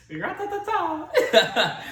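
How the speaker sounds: loud laughing gunshot noise; mock gunfire sound. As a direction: shout gratata while laughing.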